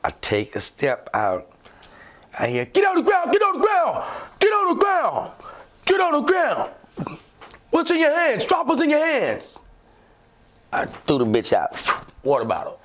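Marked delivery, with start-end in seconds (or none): 2.7-9.6 police shouting orders. Retold or imitated. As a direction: yell it like a cop with a gun drawn.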